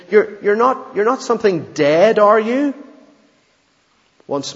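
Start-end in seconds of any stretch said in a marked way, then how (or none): 0.1-2.7 frightened voice climbing high and loud; a boy near panic, voice cracking up; almost a scream. A narrator's reading. Boy asking the unseen voice in terror, near scream. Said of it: "almost in a scream".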